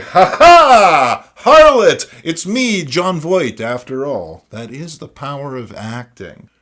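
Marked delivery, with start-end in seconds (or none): 0.0-4.4 loud booming hammy villain reveal; over-the-top mock villain; villain laugh. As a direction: bellow it like a cartoon cad. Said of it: "And he's like".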